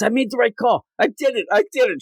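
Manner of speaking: giddy happy Kat. Retold or imitated. Kat gloating over her call, high and giddy. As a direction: cheer for yourself. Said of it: "She's so happy".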